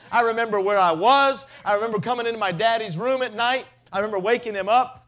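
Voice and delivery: loud, high preaching that keeps climbing; full-force preaching. Acted. Preacher to congregation, loud fervent preaching. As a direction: preach it out to the whole room.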